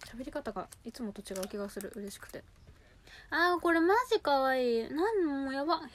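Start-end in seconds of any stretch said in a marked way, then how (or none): 3.3-5.8 high, loud gush over a color; excited. Real, not acted. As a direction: squeal with delight. (興奮した高い声)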